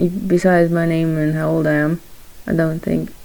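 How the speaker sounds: ordinary talk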